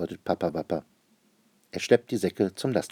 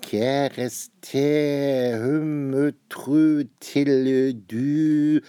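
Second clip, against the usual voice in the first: slow, higher, sing-song gibberish read word by word; child pretending to read a strange foreign letter; reading nonsense; 6 semitones higher, 5 dB louder, much slower than usual. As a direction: spell out made-up words very slowly. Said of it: "lass den Unsinn".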